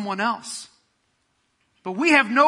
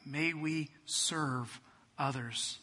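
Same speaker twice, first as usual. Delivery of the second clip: soft, lower prayer voice, fading; hushed reverent prayer; closing prayer; 4 semitones lower, 6 dB quieter than usual. Real, not acted. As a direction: pray gently over the congregation.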